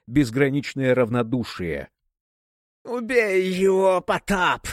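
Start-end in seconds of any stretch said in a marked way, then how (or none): 2.8-4.7 high, slow hissing command; hissed order. A narrator's reading. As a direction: hiss it like a serpent. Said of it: "прошипела верховная жрица".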